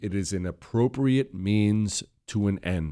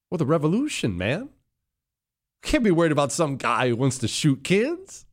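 much higher, dismissive mock voice; mocking impression; 12 semitones higher than usual. Host mocking the Speaker's view, a much higher dismissive voice. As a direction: play a callous revolutionary shrugging it off.

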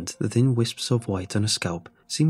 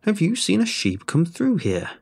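put-on higher, sharper old crone; a crone losing patience; impatient old woman; 8 semitones higher than usual. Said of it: "its kindness crackling".

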